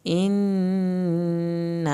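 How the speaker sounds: slow, drawn-out tajweed chant; Quranic recitation. Shown as a demonstration. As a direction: recite each word slowly, melodically. Recites verse.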